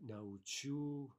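научу drawn out slowly, syllable by syllable; very slow model. Shown as a demonstration. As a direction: stretch it out so the class can follow. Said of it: "teacher models the form slowly".

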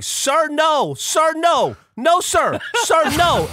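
high, loud imitated panicked shout; frantic yelling. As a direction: yell it like she's running at him in alarm. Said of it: "she's going".

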